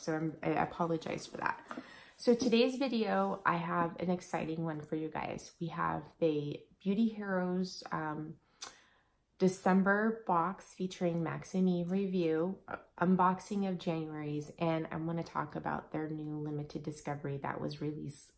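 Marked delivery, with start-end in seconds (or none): none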